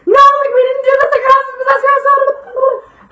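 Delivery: high, loud frantic squeal; hammed-up hysterical group protest, very high-pitched; panicked protest. Scared teens plead innocence to their friend's mom, panicked.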